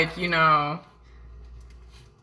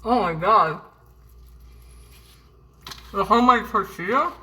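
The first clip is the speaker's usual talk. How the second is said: talking with mouth full of food; words through a mouthful. Real, not acted. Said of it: "talking with my mouth full of food".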